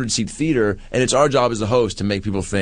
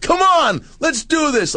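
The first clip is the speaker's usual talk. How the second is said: cheering shout; slower than usual. Retold or imitated. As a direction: pump her up from the stage. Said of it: "And I'm like".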